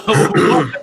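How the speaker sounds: usual speaking voice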